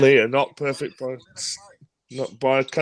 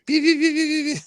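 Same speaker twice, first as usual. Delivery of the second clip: imitating high beeps. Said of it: "that was absolutely hilarious".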